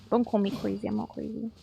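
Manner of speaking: soft, low aside to oneself; quiet aside. Pretend. Player correcting their own remark, quiet aside.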